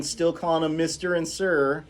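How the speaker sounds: ordinary delivery, nothing marked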